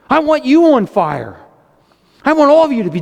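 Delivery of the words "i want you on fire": ordinary talk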